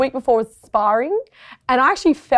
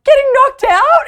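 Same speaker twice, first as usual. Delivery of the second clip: high, loud, drawn-out mock dread; panicked retelling; 14 semitones higher, 7 dB louder than usual. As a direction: play up the panic, stretching the words. Acts out her panicked answer.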